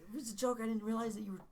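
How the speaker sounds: high-pitched retold protest; defensive pleading. Prankster calming an angry friend, defensive pleading.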